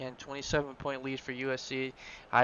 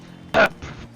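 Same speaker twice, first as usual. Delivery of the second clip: cough; 8 semitones higher, 11 dB louder than usual. Real, not acted. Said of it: "let me do my wired voice".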